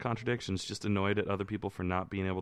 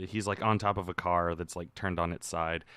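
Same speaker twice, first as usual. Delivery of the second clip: usual speaking voice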